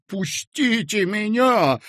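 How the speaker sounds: high, slow squeal; shrieking. A narrator's reading. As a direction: shriek to break free. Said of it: "взвизгнул Коротков".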